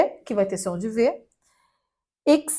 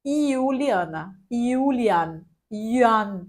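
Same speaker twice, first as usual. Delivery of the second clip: German reading of Juliana; much slower than usual. Shown as a demonstration. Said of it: "ele leria".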